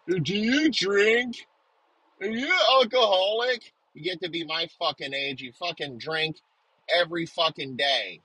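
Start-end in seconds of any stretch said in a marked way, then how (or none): 0.1-3.7 put-on high, slow whiny kid voice; mocking kids. Mocking the kids who ask if he drinks, high and slow. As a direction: mimic a prissy kid quizzing him, drawn out. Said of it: "Little fucking kids".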